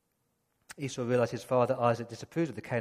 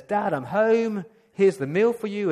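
cheerful arrival; 5 semitones higher, 7 dB louder than usual. Retold or imitated. Esau returning proudly with meal.

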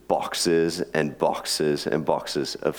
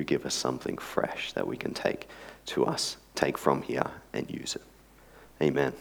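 lower, softer, reverent prayer voice; 5 semitones lower, 4 dB quieter than usual. Acted. Pastor praying before the sermon, hushed and reverent. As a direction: pray humbly over the congregation.

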